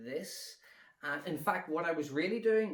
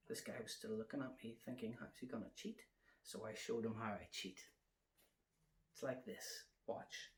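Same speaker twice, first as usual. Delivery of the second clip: hushed secret; 3 semitones lower, 12 dB quieter than usual. Acted. Secretly revealing the cheat to the audience.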